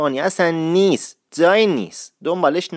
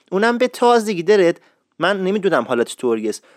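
ordinary talk

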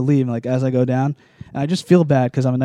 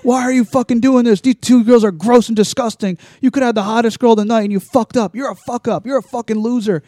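higher-pitched imitation of an angry drunk friend; mock berating, pitched up but not shouted; retold drunk friend's rant; 6 semitones higher than usual. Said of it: "my one drunk friend comes up to me".

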